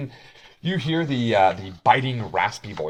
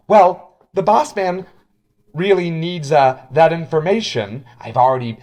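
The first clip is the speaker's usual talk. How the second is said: pushy contact; 7 semitones higher, 9 dB louder than usual. Acted. Gruff contact speaking.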